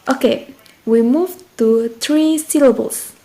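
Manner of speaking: normal talking voice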